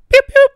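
high, loud chirping beep-beep imitation; car alarm beeps. Footballer unlocking his car for the fan, chirping high beeps. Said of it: "Alarm is off".

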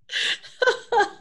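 laugh. Real, not acted. Host laughs.

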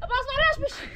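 high, loud call by name. Real, not acted. Telling a companion to go up, calling out. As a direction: raise the voice to send someone up. Calls Abbas to go up.

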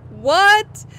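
loud, high rising question; real astonished yelp; incredulous. Real, not acted. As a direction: blurt it in sheer disbelief. Finds self spawned inside a building.

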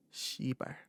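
whispered curse; cursing under breath. Real, not acted. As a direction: hiss the swear word quietly. Muttered curse after imitation.

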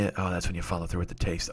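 usual speaking voice

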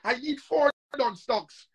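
ordinary talk

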